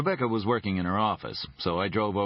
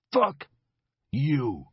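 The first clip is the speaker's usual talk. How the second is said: much higher, louder spat curse; 6 semitones higher, 4 dB louder than usual. Acted. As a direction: bite it off in anger. Leger curses the detective.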